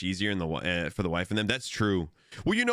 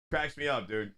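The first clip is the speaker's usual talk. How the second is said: usual speaking voice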